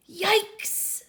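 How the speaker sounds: high startled yelp; shocked yelp. Acted. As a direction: jump at the sight of the baby. Teacher shocked by baby.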